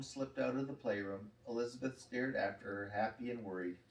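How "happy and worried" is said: plain reading voice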